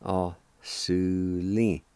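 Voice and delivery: "O-su-li" drawn out very slowly, each syllable held; very slow sounding out. Shown as a demonstration. Teacher slowly sounding out the word for learners. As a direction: stretch "O-su-li" slowly so beginners can follow. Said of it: "It's pronounced like this".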